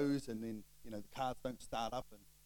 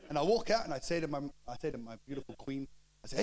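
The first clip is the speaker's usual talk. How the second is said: ordinary talk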